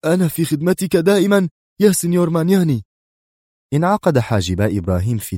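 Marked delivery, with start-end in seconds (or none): 0.0-2.8 much higher, hurried servile voice; breathless fawning inspector; panting, muttered. A narrator's reading. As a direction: gasp it out as if you ran across the world. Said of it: "ويلهث كمن قطع العالم ركضا".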